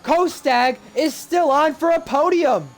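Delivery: high, loud, slow emphatic call; motorsport commentator. Real, not acted. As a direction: stress the podium news. Live race play-by-play.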